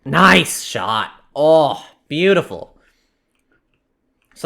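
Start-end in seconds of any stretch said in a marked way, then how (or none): 0.0-2.8 excited. Real, not acted. A good hunting shot.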